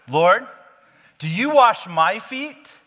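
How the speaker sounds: high, slow, loud indignant protest; hammed-up outraged disbelief. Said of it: "he's indignant".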